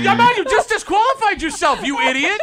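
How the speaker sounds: loud laughing disbelief. Real, not acted. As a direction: laugh through the words. Host cracks up at caller.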